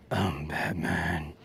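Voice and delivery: low, slow, soft Batman rasp; breathy Batman. Retold or imitated. Mocking Batman as Bane would hear it, breathy. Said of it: "Get outta here with your".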